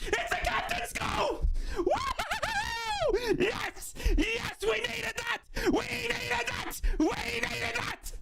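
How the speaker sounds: high, screaming, stretched-out jubilation; real goal celebration. Real, not acted. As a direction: go wild, screaming yourself hoarse for the goal. Celebrating his team's goal.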